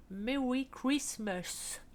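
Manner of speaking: slow, drawn-out English accent; a jokey put-on English accent; mock English accent. Shown as a demonstration. English accent to her viewers, slowly drawn out. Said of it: "mon accent superbe anglais".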